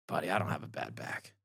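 put-on old man confesses the con, in a low soft voice; smug quiet reveal.

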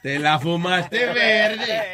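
drawn-out catchphrase, very slow; slow refrain. Acted. Host echoing caller's phrase, slow drawn-out refrain. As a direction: stretch each word out like a punchline.

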